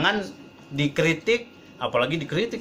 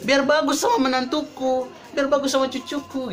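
high-pitched mock mother insisting; possessive mother; 10 semitones higher than usual. Mother claiming her right to teach her married son, possessive. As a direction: imitate a mother asserting her rights, much higher. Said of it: "tidak sedikit orang tua kan".

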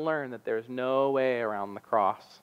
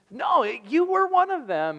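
put-on a much higher accusing girl's voice; accusing servant girl; 12 semitones higher than usual. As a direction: point the finger as the insistent girl.

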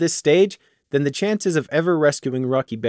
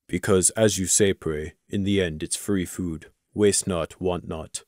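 lower, softer dutiful warrior; formal warrior; 6 semitones lower, 6 dB quieter, slower than usual. Acted. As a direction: recite the rule dutifully. Crimson's grudging admission.

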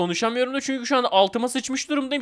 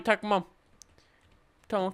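ordinary talk, nothing marked

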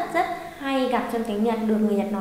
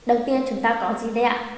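ordinary talk, nothing marked

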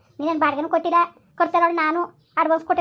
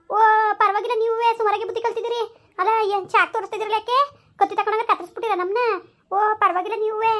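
higher, sneering taunt with a laugh; scornful mockery; 5 semitones higher than usual. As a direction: scoff at him as if the knife were a joke. Wife mocking husband's knife threat.

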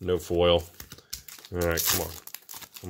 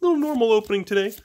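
higher, lightly put-on nonchalant voice; casual self-quote; 10 semitones higher, faster than usual. Card opener mimicking himself before the big pull, lightly mock-casual. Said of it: "And I was like".

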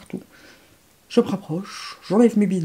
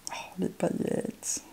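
awed whisper; 10 dB quieter than usual. Real, not acted. Awed by glitter swatches.

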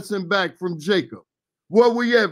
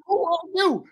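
startled Isaac; 9 semitones higher, 3 dB quieter than usual. Retold or imitated. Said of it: "I bet he can say".

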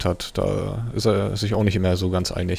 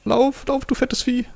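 high, squeaky urgent plea; panicked urging; slower than usual. Real, not acted. Player urges his fat dinosaur to flee, panicky. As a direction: urge it on in a high voice.

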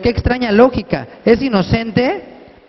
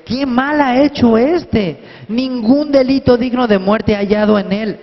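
Pilate insisting on innocence; pleading Pilate; 6 semitones higher than usual. A narrator's reading. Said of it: "Él les dijo por tercera vez". (Pilato defendiendo al acusado, voz más aguda)

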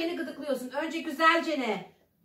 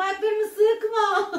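being tickled; 4 semitones higher, 4 dB louder, much slower than usual. Real, not acted. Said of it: "İnanılmaz gıdıklanıyorum".

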